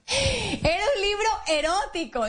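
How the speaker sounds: high, slow words through laughter; laughing. Real, not acted. Telling listeners the book was erotic, laughing. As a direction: laugh it out, embarrassed.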